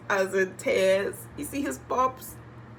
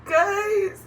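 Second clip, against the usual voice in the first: crying out; 4 dB louder than usual. Real, not acted. Breaking down at sisters' arrival.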